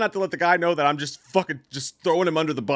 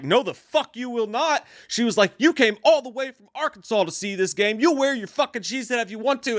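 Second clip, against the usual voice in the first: higher, indignant retold yelling; mock yell; 7 semitones higher than usual. Girlfriend defending the cheese head hat, higher mock yell. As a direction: imitate a mild indignant yell. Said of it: "you get to yell like that".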